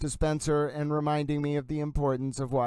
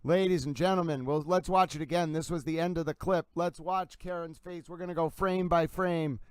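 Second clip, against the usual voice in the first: hyped replay call, pitched up; frantic mock sports commentary; 4 semitones higher than usual. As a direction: call each frame like a highlight.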